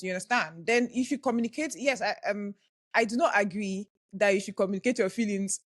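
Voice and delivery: ordinary talk, nothing marked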